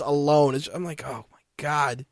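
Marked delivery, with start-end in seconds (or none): 1.0-2.0 exasperated. Acted. Host fed up with announcer's yelling, exasperated.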